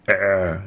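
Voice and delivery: a squeezed ع from deep in the throat; like someone choking you; صوت العين الحلقي; choking throat sound. Shown as a demonstration. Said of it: "somebody is choking your throat".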